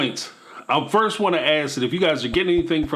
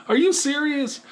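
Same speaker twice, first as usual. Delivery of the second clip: incredulous; 11 semitones higher than usual. Real, not acted. Reacting to Cody's excuse.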